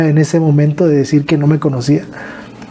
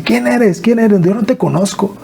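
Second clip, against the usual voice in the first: higher, bewildered question; confused mother; 6 semitones higher than usual. Retold or imitated. Mother not recognizing her son, bewildered and higher. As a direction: ask it like she truly doesn't know him. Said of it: "Mi mamá me decía".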